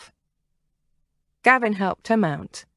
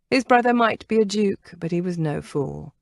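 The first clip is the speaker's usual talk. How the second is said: usual speaking voice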